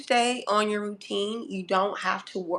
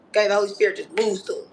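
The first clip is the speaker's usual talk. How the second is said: ordinary talk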